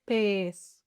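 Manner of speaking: PEZ slow and low, with a long E; slow, sustained "Pez" for the listener; drawn-out demonstration. Shown as a demonstration. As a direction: stretch the vowel in "Pez". Word stretched for listening practice.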